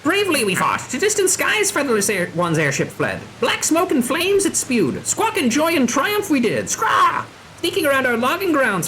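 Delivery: high, swooping squawky voice; theatrical beastman war tale, ending in squawks; boastful bird creature. Acted. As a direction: recount a battle as a proud bird-person. Voices bird creature recounting battle.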